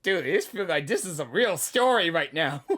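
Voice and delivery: normal talking voice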